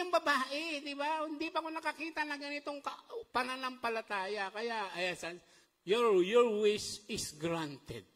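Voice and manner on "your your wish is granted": slow, loud, solemn pronouncement; solemn Lord's voice. Acted. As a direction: grant the wish like a king, very slowly. Jesus granting the woman's wish. (marahan at malakas na pagbigkas ng Panginoon)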